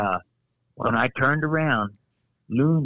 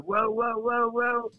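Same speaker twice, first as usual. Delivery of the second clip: teetering whoa; 9 semitones higher, slower than usual. Retold or imitated. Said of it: "I'm gonna go, 'Whoa, whoa'".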